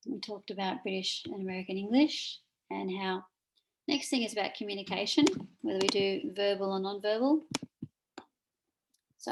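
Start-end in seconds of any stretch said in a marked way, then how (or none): none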